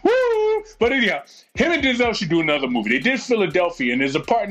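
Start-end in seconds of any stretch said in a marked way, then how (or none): 0.0-0.6 high whooping woo of excitement; cheer. Real, not acted. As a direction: let out a real excited whoop. Cheering about Denzel's new movie.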